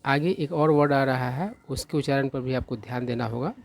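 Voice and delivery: normal talking voice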